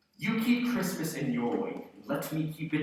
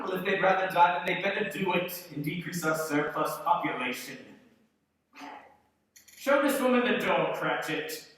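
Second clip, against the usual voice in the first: louder, harsh command; cold outburst; 5 semitones higher, 6 dB louder than usual. Acted. Scrooge ordering the charity collector out. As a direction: dismiss them with contempt.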